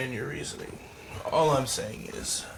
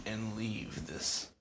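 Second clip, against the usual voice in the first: whispering; 3 semitones lower, 9 dB quieter than usual. Acted. Player whispering in character.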